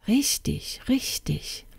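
soft, high-pitched quick agreement; muttering king. Acted. King answering the fairy's news, absent muttering.

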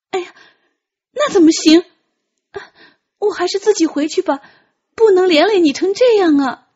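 high, loud and hurried worry; flustered young voice. Acted. Tian Qi refuses to burden Ji Zheng, flustered. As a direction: rush it out in alarm.

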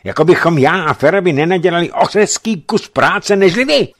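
loud, high outburst; angry outburst, loud and high; unguarded anger. A narrator's reading. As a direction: let rip without restraint. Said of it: "přestal si už úplně dávat pozor na jazyk".

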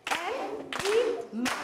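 A-E-mals, each syllable clapped apart; split into syllables. Shown as a demonstration. Teacher to class, clapping animals into syllables. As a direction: break animals into its beats.

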